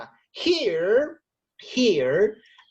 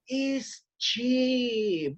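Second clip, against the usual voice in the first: ordinary talk, nothing marked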